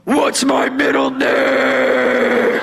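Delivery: slow, drawn-out tearful wail; wailing mock-cry challenge. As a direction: stretch it into an accusing bawl. Tearful test ending acted argument.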